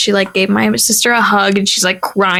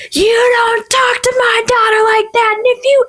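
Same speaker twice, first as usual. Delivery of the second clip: a dad yelling in defense of his daughter, much higher; retold screaming; 13 semitones higher than usual. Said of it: "they're screaming at each other".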